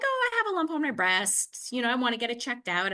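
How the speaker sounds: high, quick, casual imitation of herself; breezy, unworried. Patient casually telling doctor about a lump, breezy. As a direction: toss it off as no big deal.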